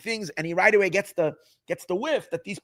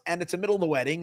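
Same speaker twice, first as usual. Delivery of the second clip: normal talking voice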